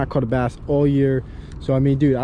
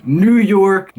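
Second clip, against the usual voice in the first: put-on higher, louder stab at a New York accent; 5 semitones higher, 5 dB louder than usual. Vlogger trying impressions from a list, New York accent. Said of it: "I can't even do a New York accent".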